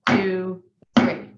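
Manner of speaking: slow, even count-in over the music. Shown as a demonstration. Drum teacher counting students in over the music. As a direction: count the band in steadily. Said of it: "I'm gonna give you three".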